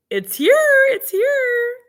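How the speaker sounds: very high, thrilled; squealing excitement. Real, not acted. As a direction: squeal with delight. Excited about snow arriving.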